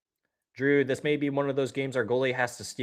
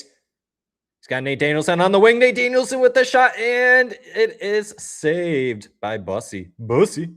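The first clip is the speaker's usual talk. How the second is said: higher and much louder rising call of the rush; excited play-by-play; 8 semitones higher, 10 dB louder than usual. Real, not acted. Calling a Red Wings rush for stream viewers, excited and loud. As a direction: call the shot like a live broadcaster.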